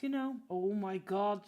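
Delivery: ordinary delivery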